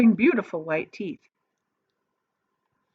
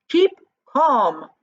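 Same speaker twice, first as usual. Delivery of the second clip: calling out; 7 semitones higher, 7 dB louder than usual. A narrator's reading. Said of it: "cried Mister Wonka".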